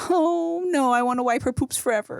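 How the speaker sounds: high, whiny mock protest; mock whine. Dad joking he'll never stop, high and whiny. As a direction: pout it like a clingy dad.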